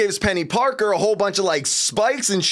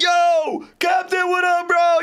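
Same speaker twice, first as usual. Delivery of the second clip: much higher, louder hailing call; bro shout; 12 semitones higher, 3 dB louder, slower than usual. Retold or imitated. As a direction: shout across to your buddy. Thor hailing Captain America.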